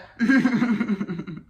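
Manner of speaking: rattling amused laugh. Real, not acted. After acting out the ancestor's correction, amused laugh. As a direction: laugh heartily in quick bursts.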